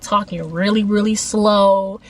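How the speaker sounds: really slow, stretched-out words; drawn-out slow. Retold or imitated. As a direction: drag out the words, lingering on slow. Mimics how slow Tony was talking.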